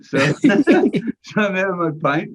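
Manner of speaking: usual speaking voice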